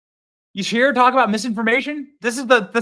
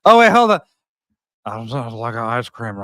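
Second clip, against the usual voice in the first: Biden impression; 9 semitones lower, 4 dB louder than usual. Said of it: "Joe Biden accent".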